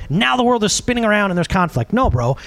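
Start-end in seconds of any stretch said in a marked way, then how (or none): none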